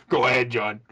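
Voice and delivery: low imitated woman's voice; deep lady voice. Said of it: "Your lady voice also got super deep".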